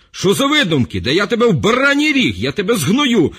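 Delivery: high, loud raging threats; enraged official threatens the sailor; furious threats. Acted. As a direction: bellow threats to crush him.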